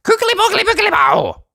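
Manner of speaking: very high, fast squeaky nonsense; goblin gibberish spell. Acted. Goblin chanting a spell over Uppity, very high and squeaky. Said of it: "something which sounded like".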